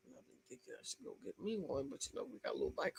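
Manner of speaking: a hushed, fast aside to the viewers; half-whispered confession, quick. Real, not acted. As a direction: drop to a secret whisper. Aside about buying a swing.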